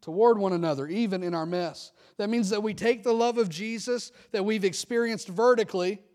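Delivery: loud, high, rousing pulpit climax; fervent preaching. Real, not acted. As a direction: build the close like a revival call. Closing preaching at full force.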